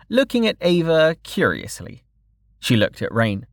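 ordinary delivery, nothing marked

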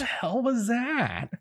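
high, disbelieving what the hell; incredulous high. Real, not acted. Echoes incredulity, higher.

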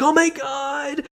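very high, drawn-out fake reunion squeal; mock gushing parents. Parents greet their returning Pegasus daughter, mock gushing. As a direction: overplay the thrilled, phony welcome.

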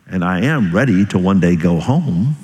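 usual speaking voice

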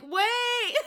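high, drawn mock cry of "wait"; calling out. Feyre stops Reese from leaving, high mock-desperate call. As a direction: call after someone in hammed-up desperation.

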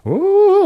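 high, loud spooky "ooh"; mock ghost wail. Imitating ghost noises from the war tapes, spooky wail. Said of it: "it's really fucking spooky".

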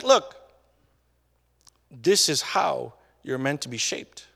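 normal talking voice

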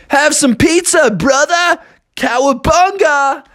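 high-pitched hyped dude voice; surfer-dude turtle. Acted. Turtles greet the frog in the sewer, hyped. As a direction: hail him with a big cowabunga.

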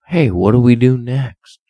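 usual speaking voice

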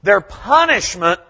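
loud, high-pitched pulpit delivery; full-force preaching. Real, not acted. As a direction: preach it out to the whole church, loud and emphatic.

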